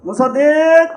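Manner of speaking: high, loud holler; impatient summoning yell; calling out. Acted. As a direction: shout the name long and high. Calls the child again, louder.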